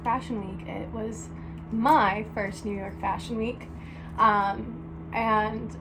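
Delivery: calm recorded narration for a vlog; vlog voiceover. Acted. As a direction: read the vlog intro evenly. Video intro voiceover.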